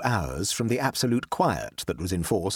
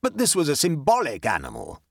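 raised-pitch, emphatic objection; objecting Sir Lulworth; 7 semitones higher than usual. Acted. Sir Lulworth correcting Lola, high and insistent. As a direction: argue the point as a learned guest.

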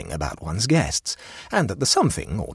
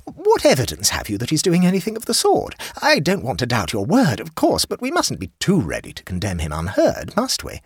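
a hint of a fussy higher hostess; lightly voiced hostess; 9 semitones higher, 4 dB louder than usual. A narrator's reading. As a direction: raise the pitch for the chatty hostess.

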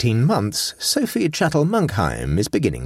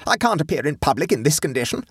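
high-pitched indignant outburst; mock scream; 10 semitones higher than usual. A narrator's reading. Guest left without hairdresser by the strike, high outraged complaint. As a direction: rage without really screaming. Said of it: "she screamed".

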